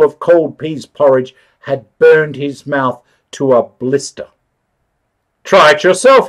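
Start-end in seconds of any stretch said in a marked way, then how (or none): 5.4-6.3 raised, pained cry, pitched up; cried out. A narrator's reading. Burned man cries out to the woman, raised cry. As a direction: blurt it out, mouth burning. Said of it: "he cried".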